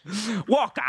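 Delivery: lightly hammed-up villain cry; imitated film villain. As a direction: call it out like a hammy villain. Quoting villain's line from film.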